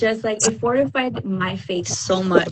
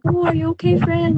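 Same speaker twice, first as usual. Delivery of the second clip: retold concern; 8 semitones higher than usual. Retells her worried phone reply.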